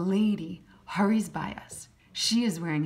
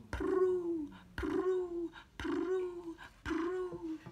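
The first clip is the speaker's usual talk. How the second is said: high cooing prue; pigeon coo; 12 semitones higher, 6 dB quieter, much slower than usual. A narrator's reading. Narrator voicing pigeons asking to be fed. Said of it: "They say".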